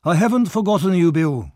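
put-on high, soothing old-man voice; smooth him over, pitched high.

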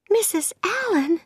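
put-on high girl's voice, taken aback; surprised. A narrator's reading. Anne looks up and finds Missus Allen, surprised. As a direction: exclaim the name in high-pitched surprise. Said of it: "she said in surprise".

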